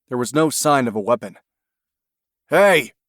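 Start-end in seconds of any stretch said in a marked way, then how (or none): 2.5-2.9 loud, high protest; protesting shout. A narrator's reading. Craig protesting as the deputy shoulders past him, shouting. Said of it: "Craig protested".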